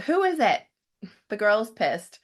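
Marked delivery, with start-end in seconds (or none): 0.0-0.6 narrator's mock yell, pitched sharply up; annoyed yell. A narrator's reading. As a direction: call out crossly to the door. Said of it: "Lois yells out".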